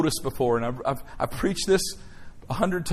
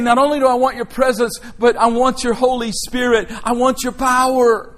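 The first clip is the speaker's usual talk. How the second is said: full-force preaching; 8 semitones higher, 6 dB louder than usual. Acted. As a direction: preach it to the back row.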